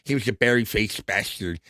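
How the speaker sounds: a lightly voiced impression of the man; imitating how he talked. Host mimicking a dead show guest, lightly voiced. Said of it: "He talked like this".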